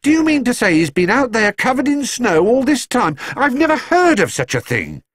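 put-on high, indignant elderly lady's voice; a scandalised housekeeper, voiced well up; shocked housekeeper. A narrator's reading. Mrs Bird voiced, high and shocked.

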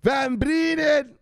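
loud, high and slow shouted name; slow rallying yell of the name. Shown as a demonstration. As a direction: yell it out to the viewers. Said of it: "Just yell out".

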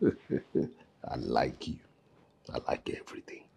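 soft breathy whisper. Acted. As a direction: whisper it close and low. Whispered aside to girl.

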